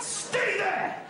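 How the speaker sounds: high-pitched shouted command; mock stern order. Parent to children strapped in the car, mock stern shout.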